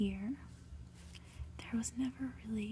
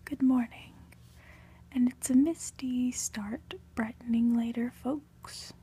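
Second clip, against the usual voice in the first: loud, breezy broadcast greeting; TV weather presenter; 8 dB louder than usual. Acted. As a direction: project it like a morning forecaster. TV weather greeting within the story.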